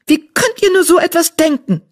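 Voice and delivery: bristling with indignation; indignant hiss. A narrator's reading. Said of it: "zischte sie mit gesträubtem Fell". (empört zischend)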